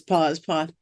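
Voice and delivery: usual speaking voice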